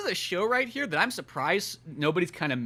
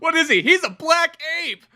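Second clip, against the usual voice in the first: mock dad; 11 semitones higher, 5 dB louder than usual. Dad voice lecturing.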